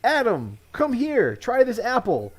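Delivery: high, coaxing temptress voice, lightly done; seductive Eve. Pretend. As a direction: lure him in with a sing-song call. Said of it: "She would have all been like".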